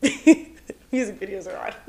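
normal talking voice

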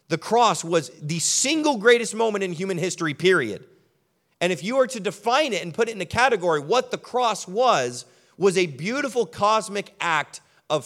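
a pastor stirring the congregation about eternal friendship; fervent preaching. Acted. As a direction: preach it with rising conviction. Preaching at full force.

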